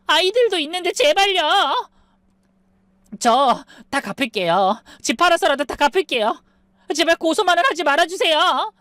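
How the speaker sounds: high-pitched desperate plea; desperate begging. A narrator's reading. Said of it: "제발 살려달라고 빌었지만".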